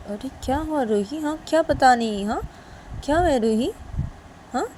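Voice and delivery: high, swooping questions; worried mother's insistent querying; concerned questioning. Acted. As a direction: press her for an answer. Mother questions evasive daughter.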